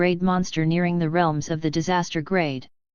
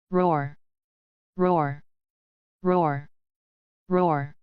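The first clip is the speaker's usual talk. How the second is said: orc roar; much slower than usual. A narrator's reading. Orcs roaring in battle.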